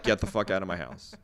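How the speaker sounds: low, soft, weary reenacted order; quiet, acted-out dismissal; deadpan dismissal. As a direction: say it low and unmoved, like a retold brush-off. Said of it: "And I'm like".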